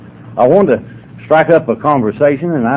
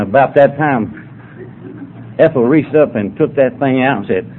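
ordinary talk

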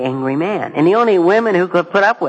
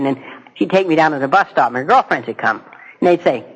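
usual speaking voice

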